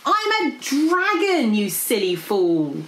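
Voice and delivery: loud, slow, high-swooping dragon voice; exasperated dragon. A narrator's reading. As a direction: draw it out, exasperated. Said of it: "sighed the golden dragon".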